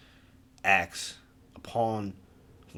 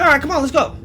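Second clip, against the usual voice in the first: eager, high and loud rallying call; excited; 11 semitones higher, 7 dB louder, faster than usual. Retold or imitated. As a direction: pump everyone up to head out. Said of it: "I'm all excited about this".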